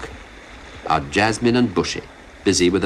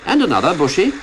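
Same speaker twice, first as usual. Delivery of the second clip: eager encouragement; 10 semitones higher, 5 dB louder, faster than usual. Acted. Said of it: "says Jasmine".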